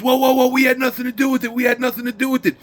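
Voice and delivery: high-pitched imitation of panicked bystanders; mock panicked protest. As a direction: back off in a high, alarmed voice. Bystanders backing off in retold fight.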